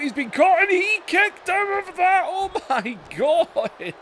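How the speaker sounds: high shouted call into a laugh; excited wrestling commentator. Real, not acted. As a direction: lose it over the kick-out. Kick-out during match call.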